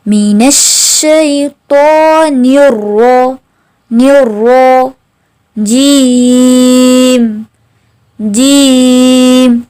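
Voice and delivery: जीम held very long; slow drilled recitation. Shown as a demonstration. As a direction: drag जीम out slowly. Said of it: "रॉ को मोटा भी पढ़ा जाएगा". (जीम बहुत लंबा खींचकर)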